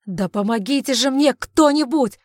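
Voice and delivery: loud, high exasperated cry; harried yell for assistance; urgent call for help. Acted. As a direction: shout for someone to step in. Calling for help in a struggle.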